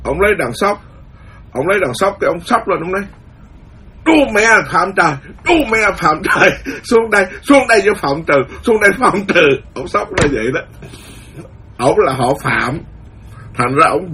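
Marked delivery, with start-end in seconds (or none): 4.0-9.5 raised, higher angry yell up at the sky; old farmer cursing the rain god, raised and higher. Retold or imitated. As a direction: curse the heavens with a shout and a laugh.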